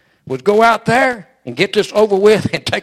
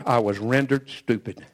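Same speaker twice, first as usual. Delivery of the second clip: much lower and softer, "stupid" let fall; hushed admission; 9 semitones lower, 10 dB quieter than usual. Acted. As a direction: drop the voice and murmur "stupid". Repeated line, emphatic then hushed.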